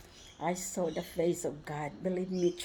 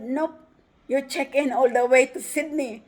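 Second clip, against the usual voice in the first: higher and louder, laughing through it; laughing quote; 6 semitones higher, 9 dB louder than usual. Retold or imitated. Traveler quoting the agent's good news, laughing. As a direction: blurt it out in delighted laughter. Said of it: "I said".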